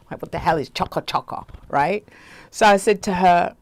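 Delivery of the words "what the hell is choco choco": ordinary talk, nothing marked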